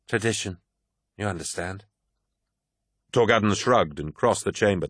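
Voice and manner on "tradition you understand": soft, apologetic aside; gently excusing, trailing softer. A narrator's reading. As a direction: offer it as a mild apology. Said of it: "Targost smiled apologetically".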